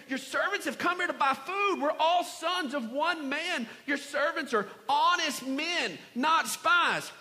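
high-pitched earnest protest; pleading brothers. Acted. Brothers protest their innocence to Joseph.

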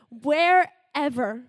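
Wherever stretched slowly for weight; drawn-out emphasis. Acted. Speaker stressing to listeners God is everywhere, slow. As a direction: lean long on Wherever. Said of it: "God is with you wherever you are".

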